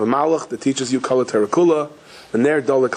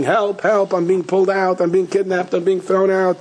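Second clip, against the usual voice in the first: high-pitched panicked cries, no real volume; mock yell for help; 6 semitones higher than usual. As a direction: imitate a frightened brother calling for help. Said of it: "starts yelling".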